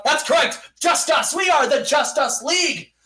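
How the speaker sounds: hammed-up hero declaration; superhero proclamation. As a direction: proudly unveil the team name. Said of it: "And then they go".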